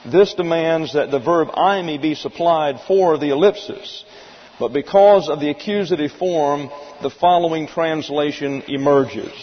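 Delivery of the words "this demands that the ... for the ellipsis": ordinary talk, nothing marked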